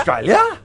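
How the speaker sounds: Australia with a high rising end; rising Australian inflection. Shown as a demonstration. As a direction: lift the end of Australia up like a question. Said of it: "we rise on the inflection".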